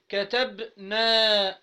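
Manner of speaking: very slow, drawn-out كتبتي, every syllable stretched; slow demo. Shown as a demonstration. Teacher slowly modelling كتبتي for the class. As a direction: dwell on each syllable of كتبتي. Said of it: "نخلي التالت ساكن".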